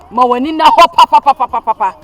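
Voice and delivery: loud, repeated insistence; insisting. Acted. Mother insisting her daughter open her eyes, loud. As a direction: drive it in again and again.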